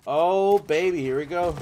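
loud, high burst of excitement; hyped up. Real, not acted. Streamer as the game action kicks off, hyped up. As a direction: belt it out, geared up for action.

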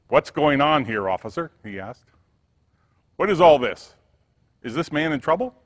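loud, indignant questions; demanding. A narrator's reading. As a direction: storm in and demand answers. Manager confronts officer.

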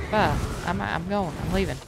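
ordinary delivery

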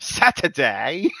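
slow, stretched "Saturday" with a laugh; mock British Saturday. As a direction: drag out "Saturday" in a mock accent. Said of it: "That's what he say".

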